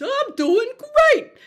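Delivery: very high, loud, slow drawn-out call of I'm doing great in an accent. Shown as a demonstration. Teacher doing a fun accented call for students. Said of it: "You can do accents".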